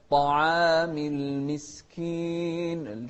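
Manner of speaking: slow, sung recitation; slow sung Quran recitation; melodic Quran recitation. Shown as a demonstration. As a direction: chant it slowly and melodically.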